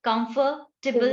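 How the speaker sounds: कंफर्टेबल in two parts, "kamfa, tibble"; reduced table ending. Shown as a demonstration. Teacher explains the rule, slow कंफर्टेबल with separated "tibble". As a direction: slowly break off a short "tih-bul" in कंफर्टेबल. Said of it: "उसको टिबल बोलोगे".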